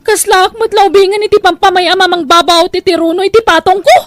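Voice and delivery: high, loud wail of pain and fear; crying out in pain. Acted. Wife crying out in pain as her husband hurts her. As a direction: cry out as if just struck.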